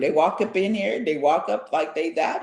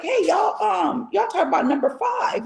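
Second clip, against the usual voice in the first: friend's call; 11 semitones higher than usual. Retold or imitated. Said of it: "So she's like".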